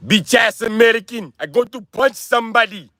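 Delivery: high-pitched, hammed-up African accent; mock African accent. Shown as a demonstration. African man threatening an American, mock African accent.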